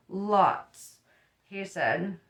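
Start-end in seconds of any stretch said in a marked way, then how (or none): 0.1-1.0 one heartfelt word; with feeling. A narrator's reading. Father on his wife's garden parties, with feeling. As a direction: say it with weary emphasis. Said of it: "with feeling".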